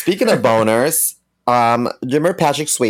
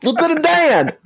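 much higher movie-quote call; Forrest Gump shout. Retold or imitated. Quoting the famous movie shout.

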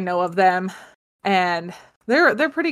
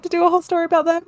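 high, fast and eager; bubbling with excitement; excited; 8 semitones higher, much faster than usual. Real, not acted. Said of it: "really excited".

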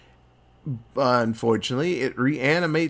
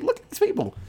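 much higher, squeaky insistence; insistent squeak; faster than usual. Retold or imitated. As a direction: jump up high to make the point. Edgar insisting, voice jumps high.

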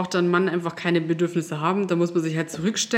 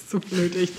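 laughing. Real, not acted. Laughs at silly pun.

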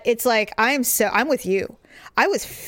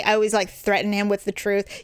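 ordinary delivery

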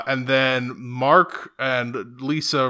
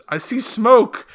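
mock bystander; 9 semitones higher, 6 dB louder, much slower than usual. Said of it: "someone says".

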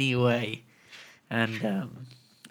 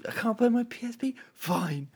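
quick, high, softer mimicry; casual kid imitation; 10 semitones higher, 5 dB quieter than usual. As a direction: toss it off lightly in a high kid voice.

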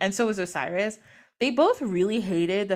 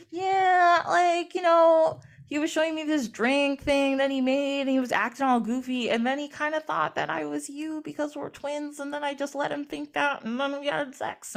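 sheepish confession; 7 semitones higher than usual. Retold or imitated. Sister confessing to Isis about Osiris, high sheepish voice.

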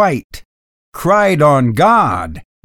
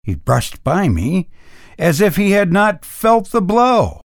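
usual speaking voice

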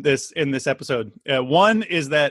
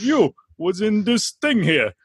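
broad working-class Bronx, higher and drawn out; comedy Bronx accent; 5 semitones higher, slower than usual. Retold or imitated. Host imitating a professor's odd accent, comedy Bronx. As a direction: caricature a Bronx tough guy's accent. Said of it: "Bronx accent or, like, some kind of working class accent".

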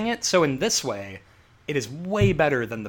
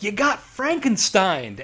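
loud, slow, higher-pitched yell; hammed-up catchphrase shout; 7 semitones higher, 5 dB louder, slower than usual. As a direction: yell it like a cheesy slogan. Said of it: "he just yells".

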